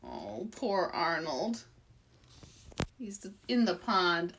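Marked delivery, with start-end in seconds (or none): none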